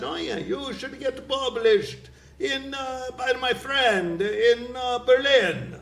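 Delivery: put-on high, slow thick Russian accent. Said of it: "That's his accent".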